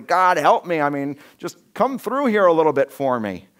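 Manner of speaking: a leader at wit's end calling out; pleading. Retold or imitated. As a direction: beg God for help, voice lifted. Moses pleading with God.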